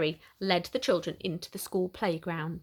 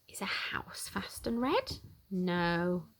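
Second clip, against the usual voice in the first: whispered, much softer, secretive; 7 dB quieter, slower than usual. Pretend. Reader whispering a guess to the children.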